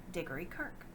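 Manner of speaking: ordinary delivery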